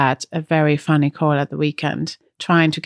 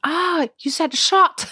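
high, drawn-out mock "ah"; retold realisation; 11 semitones higher, slower than usual. Shop worker realising she asked for shots, high mock surprise. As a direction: imitate a sudden penny-drop, high and slow.

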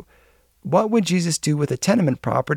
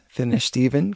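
plain reading voice

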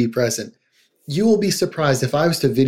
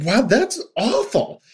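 higher, slow, emphatic dismay; matching their upset; 8 semitones higher, slower than usual. Retold or imitated. Therapist meeting a distressed patient's intensity, slow and emphatic. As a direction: meet the upset person at their level. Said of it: "come up at their level".

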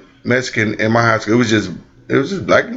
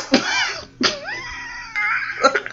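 high burst of laughter; high shrieking laugh; 7 dB quieter than usual. Real, not acted. Big laugh after punchline.

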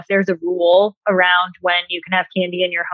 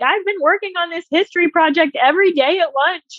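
a hint of a tearful child, higher; crying kid; 8 semitones higher than usual. Child explaining the untouched lunch to a parent, lightly tearful. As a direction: lightly play the kid near tears explaining. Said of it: "they start crying and they're like".

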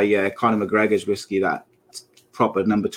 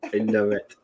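ordinary delivery

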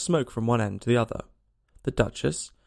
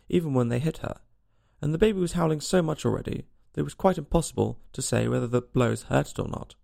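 plain reading voice

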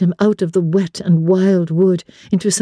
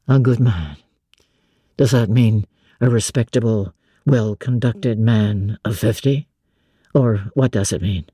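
low male voice, scoffing; 9 semitones lower than usual. A narrator's reading. Master jealously mocking the cousin, low and scornful. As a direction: lower gentleman, jealous and mocking.

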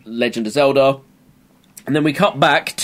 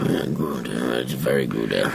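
deep pun voice; 8 dB quieter than usual. Pretend. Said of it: "cheese pun, low voice".

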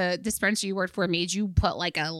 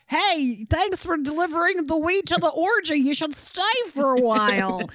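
party host voice; 6 semitones higher than usual. Acted. Acted customer at orgy.

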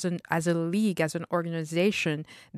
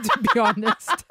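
laughing; 11 semitones higher, 5 dB louder than usual. Real, not acted. Laughing at own joke.

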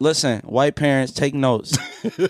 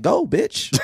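mom dismissing; 8 semitones higher than usual. Retold or imitated. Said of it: "My mom be like".

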